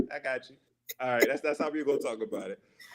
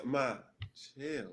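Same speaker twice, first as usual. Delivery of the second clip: imitating someone gently settling mom down; very soft, hushed plea; soft calming voice; 11 dB quieter than usual. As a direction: drop to a soothing near-murmur. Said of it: "Like, so soft, just".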